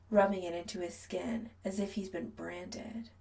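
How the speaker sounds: usual speaking voice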